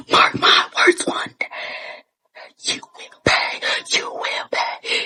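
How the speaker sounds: hushed, breathy vow of revenge; whispered threat. Retold or imitated. Whispering a curse through tears in the car.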